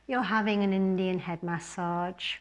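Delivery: ordinary talk, nothing marked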